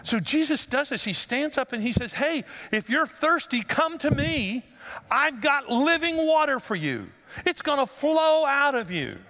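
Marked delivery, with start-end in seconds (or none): 2.1-9.2 high, slow, stretched-out calling; loud proclamation. Retold or imitated. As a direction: call out an invitation to the whole crowd. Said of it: "he said in a loud voice".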